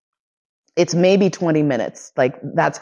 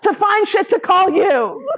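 much higher, squeaking through laughter; laughing retelling. Real, not acted. Retelling Steve's excuse, high and helpless with laughter. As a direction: quote him while you can't stop laughing.

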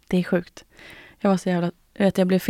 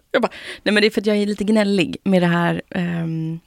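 usual speaking voice

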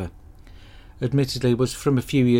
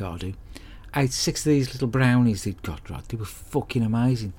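ordinary delivery

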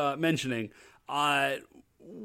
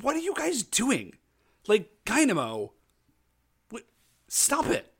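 much higher, pinched put-on female voice; high scolding voice; 7 semitones higher than usual. Vanity Mirror Fan Belt scolds Dynamo, high and shrill-voiced. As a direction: squeak it out like she's scolding them.

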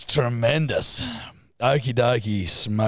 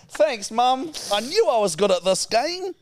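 high, put-on voice of a pleased child; mocking co-host; 8 semitones higher, slower than usual. Co-host thanking mum for points, mocking high impression. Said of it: "That is not what I sound like".

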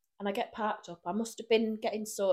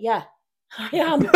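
loud, higher, cheeky admission; defiant sarcasm; 4 semitones higher, 11 dB louder than usual. Retold or imitated. Answering the woman, loud cheeky yes. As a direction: own it proudly. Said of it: "Are you being sarcastic?".

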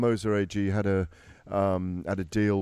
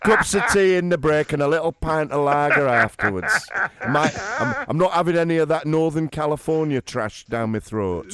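higher, much louder, hammed-up Northern English accent; broad Northern accent, played for laughs, loud; mock Northern accent; 6 semitones higher, 7 dB louder than usual. Teasing co-host by doing his Northern accent, loud and high. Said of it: "do my accent, lads".

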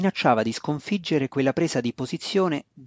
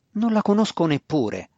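higher-pitched, sudden protest; snapped retort; 6 semitones higher than usual. A narrator's reading. Brother protesting to his priestess sister, snapped retort. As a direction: blurt it back, mildly indignant. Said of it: "sbottò Drizzt di rimando".

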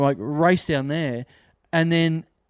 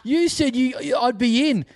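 high-pitched, stammering outrage; imitated petulant complaint; indignant punter; 8 semitones higher, slower than usual. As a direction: protest like a wronged customer, voice pushed up. Acted quarrel with angry punter.

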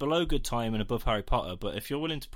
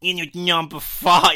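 put-on louder, higher slipping New York accent; a Lighthouse attempt that keeps going New York; failed impression drifting into New York accent; 6 semitones higher, 10 dB louder than usual. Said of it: "It's just gone New York".

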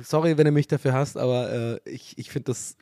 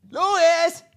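calling out; 3 dB louder than usual. Acted. Calling out to a friend.

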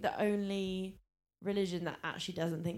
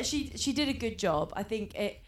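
normal talking voice

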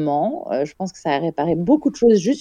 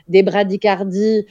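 ordinary delivery

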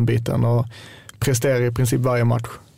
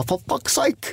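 much higher, put-on English-accented yell of frustration; Hojfeldt's odd English; 12 semitones higher, 3 dB quieter, much slower than usual. Player imitating his teammate Hojfeldt cursing on the pitch, high odd English accent. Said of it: "Han har den där konstiga engelska dialekten".

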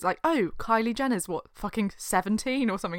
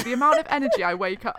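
usual speaking voice

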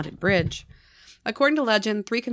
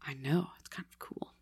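soft whispered confession; whispered aside; 4 semitones lower, 11 dB quieter than usual. Real, not acted. Host whispering to co-host that it's kind of cool. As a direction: lean in and whisper it.

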